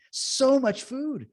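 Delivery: so much food drawn out in wonder; amazed emphasis. Real, not acted. Marvelling to his co-host about the convoy food, repeated slow and high.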